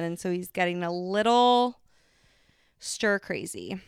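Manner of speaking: normal talking voice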